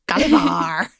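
a rough pirate growl on "Gulliver". Retold or imitated. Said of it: "In a pirate voice".

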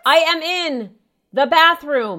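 loud, high, drawn-out shout; slow exasperated yell. Acted. Daughter yelling slowly at Mom through the door. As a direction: stretch each word so she gets it.

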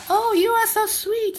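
high, drawn-out cooing praise; doting mother. Retold or imitated. Mother praising her child, high drawn-out cooing. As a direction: coo it slowly, pitched well up. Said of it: "母親が子供に".

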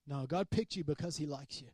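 ordinary delivery

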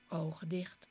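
usual speaking voice

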